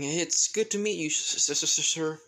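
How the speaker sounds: high, nervous stuttering greeting; flustered stammer. Acted. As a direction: stammer shyly over the word sir. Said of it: "she's blushing red like a tomato".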